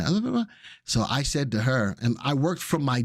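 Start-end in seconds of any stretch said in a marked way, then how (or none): 0.0-0.5 higher-pitched mock French babble; imitated French-speaking boss. Boss speaking French to her employee, mock babble. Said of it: "she was speaking French to me".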